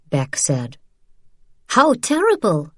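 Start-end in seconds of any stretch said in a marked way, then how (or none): 1.7-2.7 sugary sarcasm, pitched higher; sweet and bitter. A narrator's reading. Isabel mocking the German captain, sweetly sarcastic. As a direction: sound sweet but let the bitterness show. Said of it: "in a voice as sweet and bitter as burnt caramel".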